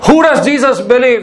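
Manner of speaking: ordinary delivery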